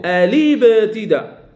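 "ألي ابتداء" slowly linked as a-libtida, no glottal stop; hamza dropped, linked. Shown as a demonstration. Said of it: "ما كنقراهاش". («ألي ابتداء» موصولة ببطء: أَلِبتداء)

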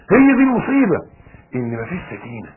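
usual speaking voice

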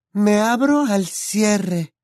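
high drawn-out test of the riddle. A narrator's reading. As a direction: lift the riddle way up and stretch it. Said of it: "dándoles diferentes entonaciones".

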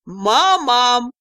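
loud, gleeful call for mother; gloating call. A narrator's reading. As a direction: call out loudly with malicious glee. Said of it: "почему-то злорадно позвал". (злорадный громкий зов)

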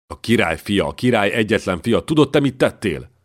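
building from tense to a high, loud cry; rising fury. Acted. Chamberlain demands if the servant knows what he did, furious. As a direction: climb to an outraged accusation.

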